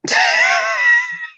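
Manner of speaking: a sudden high shriek of a laugh; burst of shrieking laughter. Real, not acted. Cohost mentions Utopia, burst of shrieking laughter.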